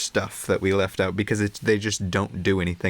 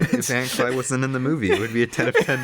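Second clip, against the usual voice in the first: rushed and laughing; 3 semitones higher, faster than usual. Real, not acted. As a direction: laugh through the punchline. Laughing reworded repeat.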